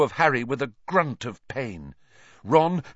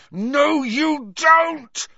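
much higher, louder roar; 6 dB louder, much slower than usual. A narrator's reading. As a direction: roar at the cat. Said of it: "roared Harry".

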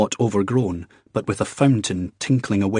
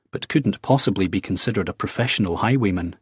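ordinary delivery, nothing marked

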